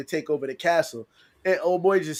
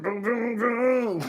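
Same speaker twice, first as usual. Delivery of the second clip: bang out the booms like a car struggling; mock boom; 8 semitones higher, much slower than usual. Friend mocking a car with no power steering, boom sounds.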